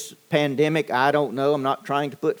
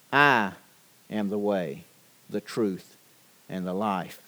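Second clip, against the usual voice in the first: hushed solemn quote; 6 semitones lower, 5 dB quieter than usual. Acted. Pastor quoting Jesus to the congregation, hushed and solemn. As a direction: drop much softer and lower for Jesus's words.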